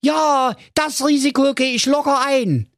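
calling out. Acted. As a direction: shout it breezily. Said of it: "rief da der Marco Magerhals".

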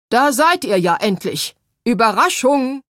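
ghostly piercing greeting. A narrator's reading. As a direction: boom it out to startle her. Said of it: "erklang eine durchdringende Stimme".

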